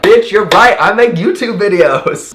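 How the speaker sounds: high, loud, amazed exclamation; gleeful over-the-top yell; excited outburst. Retold or imitated. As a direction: shout it back in hammed-up excitement. Acts out his excited reply.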